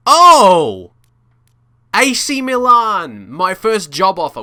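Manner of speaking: high, loud burst of surprise; real shock. Real, not acted. Gamer reacting to a first job offer, shocked. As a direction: exclaim in astonishment, pitched right up. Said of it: "I'm just really shocked by that".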